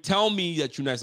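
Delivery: ordinary talk, nothing marked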